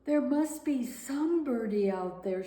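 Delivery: sad. Acted. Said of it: "she said sadly".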